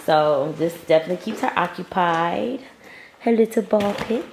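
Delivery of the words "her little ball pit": normal talking voice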